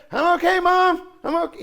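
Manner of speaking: put-on young boy happily answering his mother; cheerful boy. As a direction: reassure mom brightly. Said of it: "he said".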